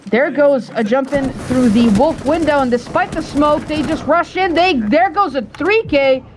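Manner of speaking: rising excitement on the big play; excited play-by-play. Real, not acted. Clutch play erupts live.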